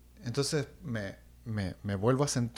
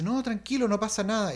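reassuring mother; 7 semitones higher than usual. Retold or imitated. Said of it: "mi mamá dice".

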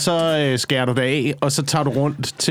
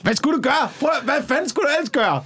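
much higher, exasperated question; indignant; 10 semitones higher than usual. Real, not acted. Friend arguing why beheading was necessary, exasperated. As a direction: sound fed up and incredulous.

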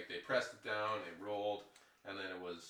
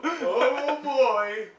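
loud, high, hammed-up ghost; goofy ghost voice; 14 semitones higher, 16 dB louder than usual. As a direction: ham up a silly spooky ghost. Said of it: "I'm not the ghost voice actor".

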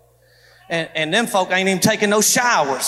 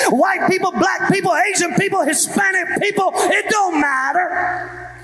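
preaching at full force; 7 semitones higher than usual. Acted. Pastor declaring against segregation to his church, full preaching shout. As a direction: drive it home to the congregation.

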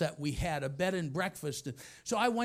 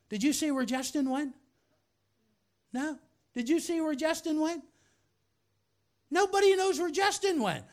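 rising, louder calls for Justin; searching and getting more riled; getting upset; 9 semitones higher, 4 dB louder than usual. Retold or imitated. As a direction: boss calling round the office, rising impatience.

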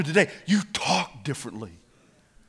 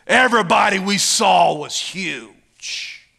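loud scripture reading, slightly raised; dramatic preaching; 5 semitones higher, 6 dB louder than usual. Real, not acted. Preacher reading the scouts' scary report, loud declamation. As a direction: declaim the scary rumors to the crowd.

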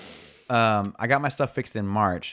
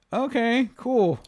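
mock agreeable; 11 semitones higher, much slower than usual. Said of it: "and I'm like".

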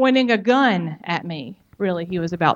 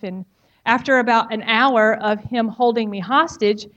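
ordinary talk, nothing marked

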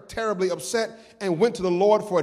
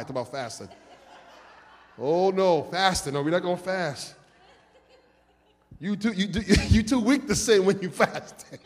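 laughing. Real, not acted. Joking about fasting, laughing.

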